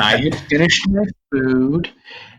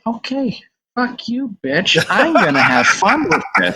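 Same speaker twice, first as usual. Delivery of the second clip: put-on defiant kid; 10 semitones higher than usual. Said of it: "I'm like".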